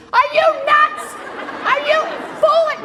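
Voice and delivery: acted high-pitched outraged scream; screaming at him. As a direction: scream each accusation, high and loud. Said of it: "she starts screaming at him like".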